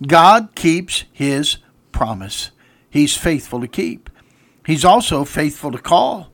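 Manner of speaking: normal talking voice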